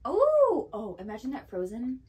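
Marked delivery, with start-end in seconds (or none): 0.1-0.7 loud, high surprised oh; surprised gasp. Real, not acted. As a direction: exclaim in sudden delight. Surprised by drink.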